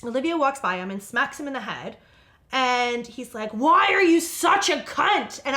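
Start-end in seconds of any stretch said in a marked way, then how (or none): 3.5-5.4 high, loud, drawn-out mock outrage; imitated outraged child. Son smacked in the head by Olivia, mock-outraged and high. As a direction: wail it slowly like an exasperated little kid.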